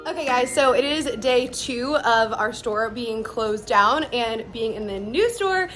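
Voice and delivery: normal talking voice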